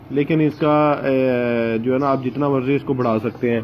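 ordinary talk, nothing marked

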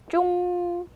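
"쫑" held high on a long ng; demonstrating "쫑". Shown as a demonstration. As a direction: model "쫑" slowly for learners. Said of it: "이렇게 되죠".

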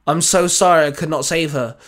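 ordinary delivery, nothing marked

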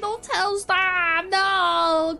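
high, drawn-out protest; whiny pleading. Pretend. Caught swearing, begging not to be told on, high whine. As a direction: beg in a high wail, coming down gradually.

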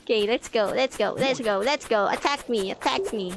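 ordinary talk, nothing marked

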